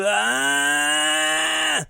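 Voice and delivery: long drawn-out "aah" mimicking a child; imitation of a child's sustained cry; imitated kid's wail. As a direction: wail like a kid having a meltdown. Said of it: "and then Brock goes".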